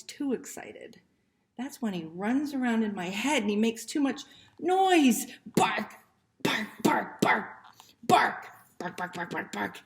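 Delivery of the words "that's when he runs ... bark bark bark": frantic barking. Acted. Puppy barks wildly in head.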